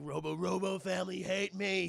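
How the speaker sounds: put-on mournful robot voice; sad robot. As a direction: imitate a rejected giant robot.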